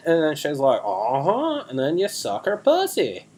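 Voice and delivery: bro storyteller with high mimicked quotes; boastful dude-bro character; Chaz. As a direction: swoop way up for the quoted chat. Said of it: "we're doing characters this week".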